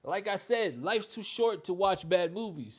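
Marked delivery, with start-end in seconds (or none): none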